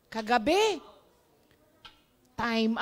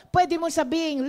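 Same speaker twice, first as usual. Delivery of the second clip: ordinary talk, nothing marked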